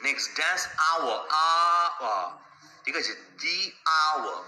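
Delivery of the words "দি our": ordinary talk, nothing marked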